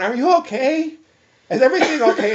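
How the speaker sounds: loud, high worried questioning; retold alarmed callers. Friends hearing patient grunt on phone, alarmed. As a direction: call out in mounting concern. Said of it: "they would be going".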